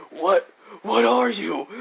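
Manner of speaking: rattled villain's outcry; shocked demand. Retold or imitated. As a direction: demand it in shock, pitch climbing. Shocked villain in retold story.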